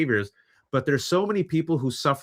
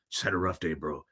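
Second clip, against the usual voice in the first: low, muted mumbling guy; gruff dude; 6 semitones lower, 8 dB quieter than usual. Retold or imitated. Crying man answering a stranger, low and mumbled. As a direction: sink into a low, softer dude voice. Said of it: "You're like".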